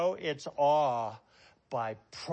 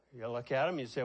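ordinary talk, nothing marked

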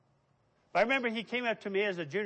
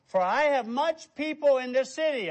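emphatic proclamation; 7 semitones higher, 8 dB louder than usual. Acted. God's words quoted with force.

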